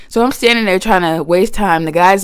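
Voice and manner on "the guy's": ordinary delivery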